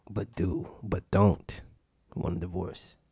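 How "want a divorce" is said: soft, low throwaway line; quiet aside. Acted. Comic to audience after warning, quiet aside. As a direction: slip it in under your voice.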